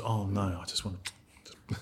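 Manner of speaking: soft mock reluctance, trailing off; timid turtle retreat. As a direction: back away from the fight, barely audible. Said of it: "who was the turtle?".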